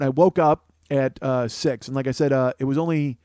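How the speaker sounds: ordinary talk, nothing marked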